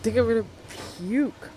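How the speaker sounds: gagging, about to puke; disgusted by smell. Acted. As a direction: retch at the smell. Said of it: "it smells awful".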